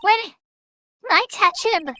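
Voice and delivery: put-on loud, high old-lady threat; winded and fuming, punch each word; huffing old lady. Old lady chasing the boy, huffing angry threat. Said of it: "all the huffing and puffing".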